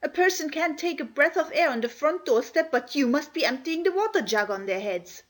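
scandalised scolding; Martha, angry scolding. Acted. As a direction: tell the children off, indignant but not shouting. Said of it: "she was very angry indeed".

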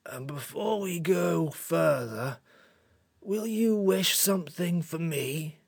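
slow, soft, tired creature; drained creature, hushed; weak. A narrator's reading. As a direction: ask faintly, drawing out the words. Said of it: "its voice was weaker".

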